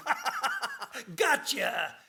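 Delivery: high, laughing devil's taunt, stretched slow; gloating devil. Retold or imitated. The devil taunting the prisoner he has trapped, high and gloating. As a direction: laugh it out like the devil springing a trap. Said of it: "the devil is standing there going".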